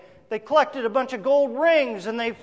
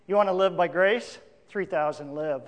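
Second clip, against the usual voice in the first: gentle assurance; 4 semitones lower than usual. Shown as a demonstration. Preacher offers grace to the congregation, gently. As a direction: soften right down on the answer.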